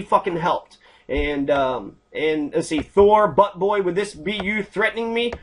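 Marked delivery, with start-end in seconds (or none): none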